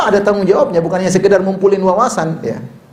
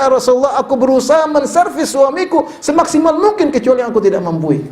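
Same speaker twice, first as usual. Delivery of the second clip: devoted wife; 8 semitones higher than usual. Retold or imitated. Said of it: "Kata dia".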